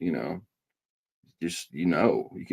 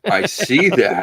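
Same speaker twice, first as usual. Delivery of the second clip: excited; 11 semitones higher, 7 dB louder, slower than usual. Real, not acted. Spotting dog in background.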